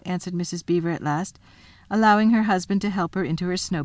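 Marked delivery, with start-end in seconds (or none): none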